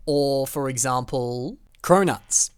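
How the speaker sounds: ordinary talk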